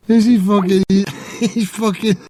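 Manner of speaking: laughing through the words; can barely get it out for laughing; words broken up by laughter. Real, not acted. Laughing at the caller.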